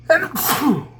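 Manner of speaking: sudden real sneeze, high burst. Real, not acted. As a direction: let a sneeze escape. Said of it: "And I'm about to sneeze".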